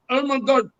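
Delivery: put-on Indian accent, astonished; imitated Indian accent. Fan spots him in Vegas, imitated Indian accent. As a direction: exclaim in a mock Indian accent. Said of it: "And this Indian guy".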